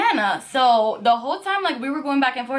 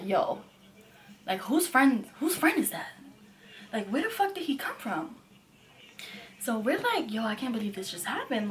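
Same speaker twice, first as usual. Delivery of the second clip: soft, shocked disbelief; stunned hush; 7 dB quieter than usual. Retold or imitated. Friends at the table after he left, stunned and hushed. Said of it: "'Cause we're like".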